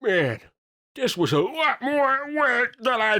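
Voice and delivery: high, strained silly voice; a goofy character, way up high; tired goofball in a high voice; high cartoon voice. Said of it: "And he goes".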